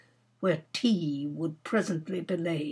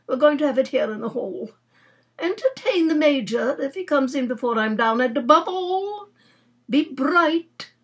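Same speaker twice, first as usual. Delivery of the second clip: lightly voiced aunt, higher and louder; bossy aunt; 6 semitones higher, 4 dB louder than usual. Aunt instructing her niece before tea, bossy.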